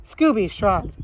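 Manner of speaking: ordinary delivery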